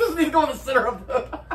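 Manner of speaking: laughing through the words. Real, not acted. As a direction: crack up mid-sentence. Repeats line while laughing.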